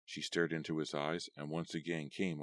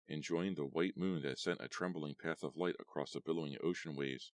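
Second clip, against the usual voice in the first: usual speaking voice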